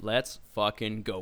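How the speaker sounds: usual speaking voice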